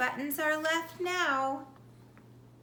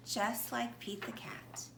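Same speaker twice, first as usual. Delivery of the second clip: soft whisper to close the story; 7 dB quieter than usual. Acted. Reader to children, whispering the moral. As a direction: lean in and whisper it to the kids.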